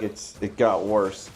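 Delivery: usual speaking voice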